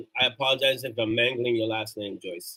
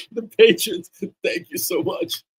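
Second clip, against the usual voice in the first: hammed-up choked thanks, much higher and slowed; mock teary; 10 semitones higher, 5 dB louder, slower than usual. Host thanking new patrons on stream, mock teary high voice. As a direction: play a fake-weepy, over-the-top thank you. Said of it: "I'm not getting emotional".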